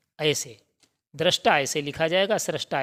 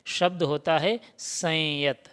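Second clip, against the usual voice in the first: ordinary talk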